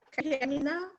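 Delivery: ordinary talk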